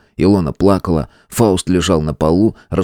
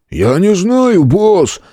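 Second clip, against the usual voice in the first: mumbling thug; 11 semitones higher, 4 dB louder, much slower than usual. A narrator's reading. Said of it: "промычал громила справа".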